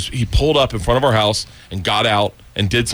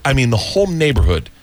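ordinary talk, nothing marked

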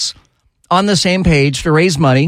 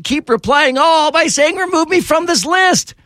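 mock exasperation; 11 semitones higher than usual. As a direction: pitch climbing high in mock disgust. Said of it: "to everyone and say".

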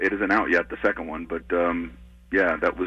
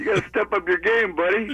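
high-pitched retold ribbing; mock scolding; 11 semitones higher than usual. Backstage guy to comic about his rider, mock scolding. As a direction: imitate a guy needling the star.

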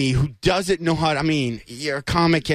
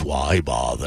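much lower, mopey donkey groan; gloomy donkey voice from the cartoon; Eeyore; slower than usual. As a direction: drop way down and sound hopeless. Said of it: "anyone can do Eeyore".

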